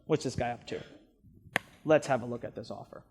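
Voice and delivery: low, quiet, no-nonsense take-charge voice; the conscious mind taking over to inspect the offer; stern inner voice. Retold or imitated. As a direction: step in firmly and skeptically. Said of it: "the conscious mind steps up and says".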